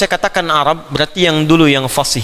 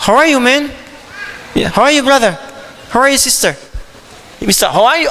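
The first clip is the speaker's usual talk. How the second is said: light mock English accent, higher; playful English greetings; 6 semitones higher than usual. Teacher playfully showing English sounds, mild English accent. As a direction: greet like a friendly English speaker. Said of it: "Inggris ada".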